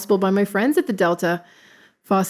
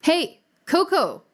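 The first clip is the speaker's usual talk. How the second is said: calling out; 9 semitones higher, slower than usual. Real, not acted. Calling out to the producer.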